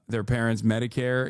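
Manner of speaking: ordinary talk, nothing marked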